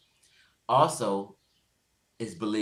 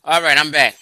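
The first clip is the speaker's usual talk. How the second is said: shouted, higher, back to the mic; loud return; 7 semitones higher, 17 dB louder than usual. Real, not acted. Returning loudly to the stream.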